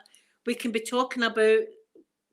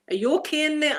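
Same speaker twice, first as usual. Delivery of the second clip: calling through a door; 6 semitones higher, 5 dB louder, faster than usual. Retold or imitated.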